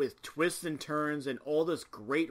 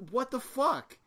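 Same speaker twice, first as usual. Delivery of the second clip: incredulous; 9 semitones higher than usual. Retold or imitated. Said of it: "And I'm like".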